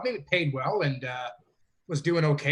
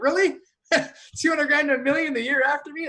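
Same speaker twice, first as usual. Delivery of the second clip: incredulous, much higher-pitched protest; mock complaint; 9 semitones higher, 3 dB louder than usual. Winner griping about the bigger prize a year later, mock complaint. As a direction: complain in high, laughing disbelief. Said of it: "that sounds like a complaint".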